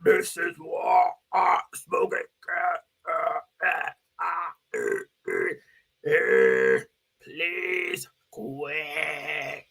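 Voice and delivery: low, slow, raspy and tearful ad voice; cancer ad lady. Retold or imitated. Mocking the anti-smoking commercial lady with a low, slow, tearful croak. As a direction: croak it out like the dying woman in the ad. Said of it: "Remember those ladies?".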